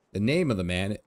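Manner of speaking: ordinary talk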